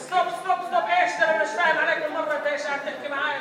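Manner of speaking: fast, high, loud Arabic babble; hammed-up bewildered rant; feigned confusion. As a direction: protest rapidly, playing clueless.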